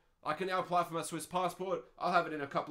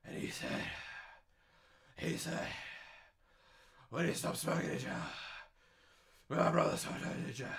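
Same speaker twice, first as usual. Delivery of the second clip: softer, breathy mock fat guy with heavy breaths; heavy breathing; 4 dB quieter than usual. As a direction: puff through it like a winded big man. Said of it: "heavy breathing like that".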